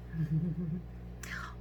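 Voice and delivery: hushed, breath-only chuckle; soft whispered laugh. Real, not acted. Grandparent by a drowsy child, hushed laugh. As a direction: laugh under your breath.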